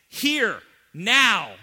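loud, high, two hammered words; commanding shout. Acted. Jesus's literal Greek command to Lazarus, shouted. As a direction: snap each word as an order. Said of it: "Literally in the Greek".

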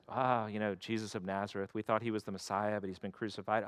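understated disappointed travelers; glum disciples. Retold or imitated. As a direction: mumble the bad news sadly. Downcast disciples on the road.

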